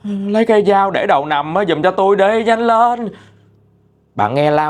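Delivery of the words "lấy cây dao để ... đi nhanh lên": high, acted panicky plea; urgent sleep-talk cries. Dreaming mother begs for a knife by her pillow, acted urgent cry. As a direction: plead urgently like someone crying out in a nightmare. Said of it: "xong cái lại mớ tiếp".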